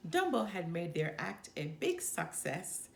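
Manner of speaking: usual speaking voice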